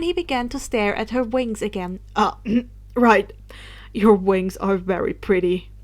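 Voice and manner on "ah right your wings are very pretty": ordinary delivery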